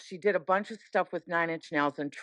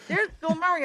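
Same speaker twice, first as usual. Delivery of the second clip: higher, awed repeat of the name; excited; 11 semitones higher, faster than usual. Retold or imitated. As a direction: hit it like a star sighting. Ricky, you're not ready for this.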